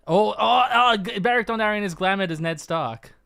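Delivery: loud excited eureka guess; shouting pictionary guesses. Real, not acted. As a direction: blurt out the answer, thrilled.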